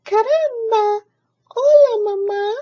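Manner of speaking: put-on very high, loud baby chick voice. Baby chick greets cat as mama, very high and loud. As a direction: chirp it up at mama. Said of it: "looked up at Jack and said".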